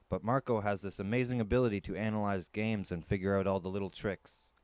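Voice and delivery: ordinary delivery, nothing marked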